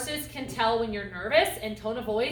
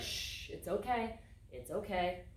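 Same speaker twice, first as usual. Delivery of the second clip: soft, slow shushing reassurance; calm a spooked horse gently; soothing reassurance; 3 semitones lower, 8 dB quieter, slower than usual. Shown as a demonstration. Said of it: "petting and reassuring".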